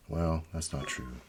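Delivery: ordinary talk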